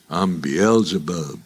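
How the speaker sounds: low, slow devil voice, lightly done; lightly voiced devil. Devil answers the frightened man, low and slow. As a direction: intone it like the devil, slowly. Satan introduces himself.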